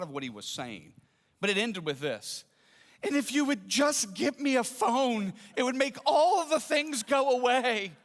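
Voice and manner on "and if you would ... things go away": imitating weepy plea. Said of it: "And he starts weeping".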